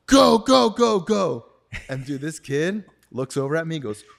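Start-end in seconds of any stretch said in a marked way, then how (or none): 0.1-1.5 frantic high yell, much louder; shouting to flee in terror; panicked shout. Retold or imitated. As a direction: scream go go at the driver to floor it. Yelling at friend to drive.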